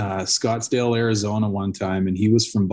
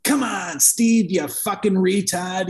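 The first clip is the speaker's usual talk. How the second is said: higher mock Boston golfer scolding himself; imitating a Boston guy berating himself, pitched up; Boston accent; 8 semitones higher, slower than usual. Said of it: "And he's like".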